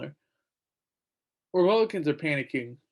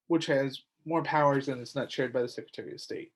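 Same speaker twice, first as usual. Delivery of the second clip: ordinary talk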